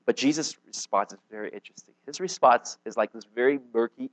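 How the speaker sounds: usual speaking voice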